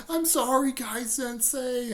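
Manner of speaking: mock contrite, high voice; high-pitched apology. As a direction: plead upward, slowing on the teacher's name. Lee apologizes after being punched.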